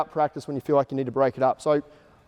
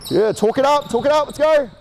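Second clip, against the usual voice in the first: coaching shout; 9 semitones higher than usual. Real, not acted. Coach to players mid-drill, shouting encouragement. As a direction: drive the players on from the sideline.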